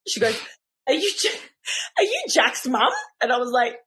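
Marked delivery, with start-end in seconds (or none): 0.9-2.9 lightly voiced swim teacher, pitched up and prim; a touch of a bothered teacher stopping a parent; huffy teacher. Swim teacher confronting the mum, higher and prim. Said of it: "she's walking along in a huff".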